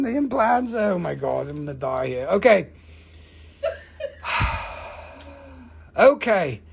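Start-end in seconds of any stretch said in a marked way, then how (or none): none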